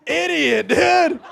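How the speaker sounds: normal talking voice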